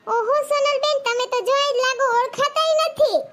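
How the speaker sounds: high, loud delighted surprise; amazed. Real, not acted. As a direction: gasp at how lovely she looks. Amazed at dressed-up friend. (ઊંચા, મોટા અવાજે હરખભર્યું આશ્ચર્ય)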